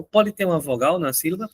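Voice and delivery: ordinary delivery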